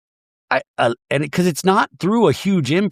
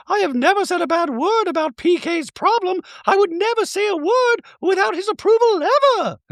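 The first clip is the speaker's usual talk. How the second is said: mock Dorit voice. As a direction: play her as a haughty caricature.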